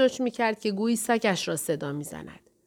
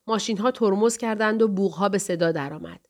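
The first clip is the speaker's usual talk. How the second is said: ordinary delivery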